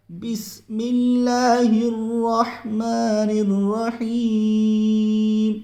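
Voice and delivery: slow chant, الرحمن full-mouthed and الرحیم light; tajweed recitation. Shown as a demonstration. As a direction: hold the vowels, heavy on الرحمن, thin on الرحیم. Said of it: "رحمان کو ناظرین پر کر کے".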